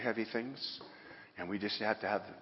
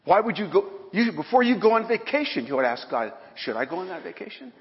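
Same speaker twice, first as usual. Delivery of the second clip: loud, high fervent preaching; preaching at full force; 7 semitones higher, 10 dB louder than usual. Acted. Preacher urging his congregation to pray first, in a loud fervent cry. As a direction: pour it out like a revival preacher.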